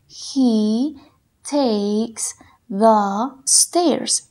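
very slow, stretched words; very slow dictation. Shown as a demonstration. Teacher dictating slowly for students to write. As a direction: draw out every word. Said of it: "Trata de escribirlos de acuerdo como los escuches".

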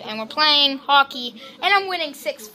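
ordinary talk, nothing marked

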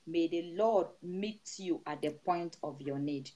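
steady, rhythmic prayer over the flock; prayerful blessing. Acted. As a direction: pray over the congregation with rising fervour. Pastor praying over the congregation.

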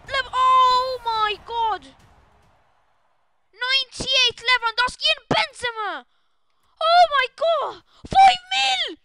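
high, loud screams of disbelief; ecstatic shouting. Real, not acted. Streamer celebrating a huge pack pull, ecstatic shouting. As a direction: yell it out, stretching the big words.

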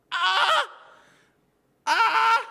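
sharp high shriek; high squeal. Real, not acted. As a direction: squeal in alarm. Squealing while pouring.

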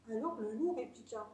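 ordinary delivery, nothing marked

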